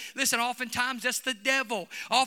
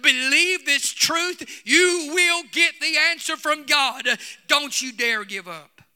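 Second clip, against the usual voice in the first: loud, driving preacher delivery, pitched up; full-force preaching; 5 semitones higher, 8 dB louder than usual. Real, not acted. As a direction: drive each clause home like a revival preacher.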